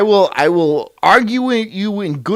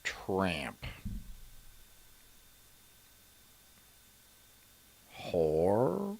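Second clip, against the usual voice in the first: low, quiet mock child's verdict; imitating the child; 10 semitones lower, 15 dB quieter than usual. Podcaster voicing the boy judging his mom, low. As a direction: mutter the words low as the boy. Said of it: "he's like".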